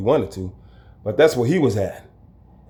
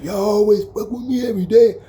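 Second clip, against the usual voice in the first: whiny complaint; 10 semitones higher than usual. Retold or imitated. Thrower voiced complaining.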